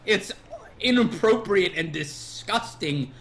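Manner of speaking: mock grandiose. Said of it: "mock-grand description of own humor".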